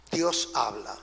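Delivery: low, hushed pronouncement; solemn refrain. Real, not acted. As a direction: drop right down and state it softly. Solemn refrain answering each loud clause.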